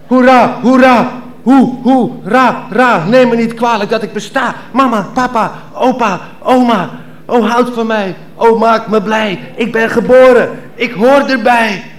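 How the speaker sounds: newborn cheering. Acted. Newborn cheering at his own birth, joyful. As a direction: cheer like a baby just born.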